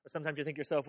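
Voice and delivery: ordinary talk, nothing marked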